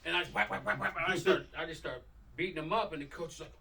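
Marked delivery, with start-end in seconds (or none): none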